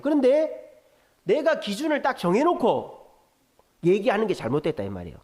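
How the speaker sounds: usual speaking voice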